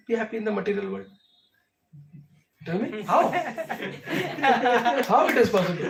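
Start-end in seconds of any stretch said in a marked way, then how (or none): 2.6-3.2 asking through real laughter; laughing. Real, not acted. Laughing at his own rhetorical question. 3.3-5.1 short real laugh, higher; amused laugh. Real, not acted. Laughs mid-argument. 5.1-5.8 asking through real laughter; laughing. Real, not acted. Laughing at his own rhetorical question.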